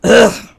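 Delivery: loud, high groan of annoyance; exasperated ugh. A narrator's reading. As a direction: groan at him. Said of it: "said the other naked mole rats".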